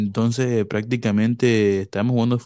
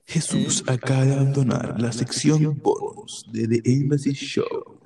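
announcer voice with echo; 3 semitones higher than usual. Pretend. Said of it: "dije tipo, o sea, con el eco".

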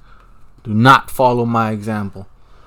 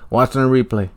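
ordinary delivery

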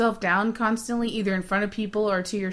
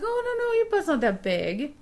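reassuring friend; 8 semitones higher than usual. Retold or imitated. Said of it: "my mother and say".

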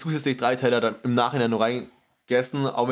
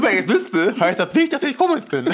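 laughing; 5 semitones higher, 4 dB louder than usual. Real, not acted. Laughs through his excuse.

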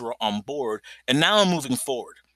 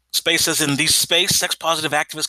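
ordinary delivery

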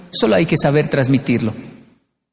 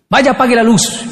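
higher, curt grandfatherly order; bossy grandfather; 7 semitones higher than usual. Retold or imitated. Grandfather orders the boy to turn off the light, curt. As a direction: snap the order at the kid. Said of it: "los adultos nos decían".

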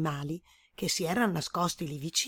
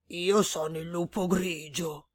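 grey wolf introducing himself. A narrator's reading. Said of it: "si presentò".

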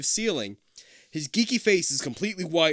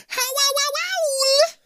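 slow, very high screechy howl; wolf howl; 7 dB louder, much slower than usual. A narrator's reading. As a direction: howl like a bad werewolf. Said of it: "a bone-chilling".

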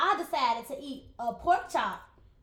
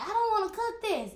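high, loud whining complaint; whiny protest; 9 semitones higher, 6 dB louder than usual. Retold or imitated. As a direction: whine it like a crying cook. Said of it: "be mad and be crying the whole time".

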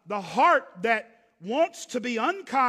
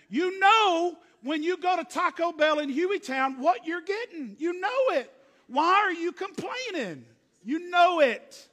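higher, louder mock exasperation; exasperated rant; 6 semitones higher, 4 dB louder than usual. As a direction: scold complainers in half-joking disbelief. Exasperated rant about Taco Bell complainers.

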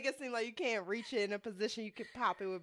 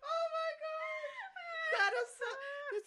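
laughing-crying. Real, not acted. Overcome with laughter.

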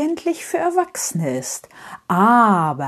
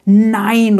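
loud, drawn-out cry of denial; angry refusal, much louder; 3 semitones higher, 8 dB louder than usual. A narrator's reading. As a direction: burst out in storybook anger. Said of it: "ruft Herr Uralt da laut und wütend".

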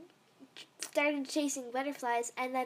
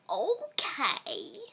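lightly voiced old lady; creaky little old lady voice; 2 semitones higher than usual. A narrator's reading. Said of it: "in one of her old lady voices".

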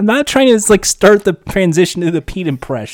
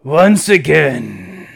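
a hint of low slow villain; slow, low growled line; Deathstroke voice; 6 semitones lower, much slower than usual. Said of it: "do your Deathstroke".

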